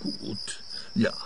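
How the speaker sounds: whispered, resigned giving-in; defeated whisper. Acted. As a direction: barely breathe it out in defeat. Suspect gives in to inspector.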